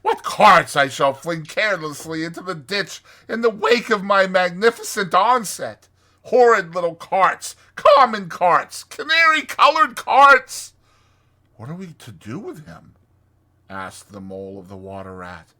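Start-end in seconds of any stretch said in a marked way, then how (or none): 0.0-10.7 high, loud, grandiose boasting; puffed-up, ringing Toad voice; exultant Toad. Acted. Toad raves about motor car. 11.6-12.9 higher, soft little Mole voice; small, high-pitched helpless query; worried Mole. Acted. Mole asks the Rat.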